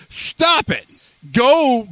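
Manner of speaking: normal talking voice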